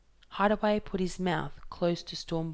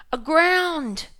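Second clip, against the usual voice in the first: much higher, much louder yell; 11 semitones higher, 10 dB louder than usual. Acted. Hideaway answers Fingerbone over the wind, loud high yell. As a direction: fling the word over the storm. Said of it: "yelled Hideaway".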